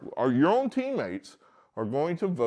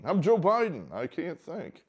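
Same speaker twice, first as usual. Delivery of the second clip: a hint of a confused old Biden; imitating Biden; mock Biden voice; 7 semitones higher than usual. Mocking Biden to a podcast audience, lightly voiced.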